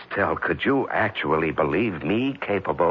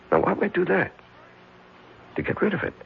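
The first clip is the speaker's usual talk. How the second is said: higher and bitterly sarcastic; bitter mock reasoning; 13 semitones higher, faster than usual. Husband taunting his wife's suspicions, sarcastic. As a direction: spell out the case against himself with scorn.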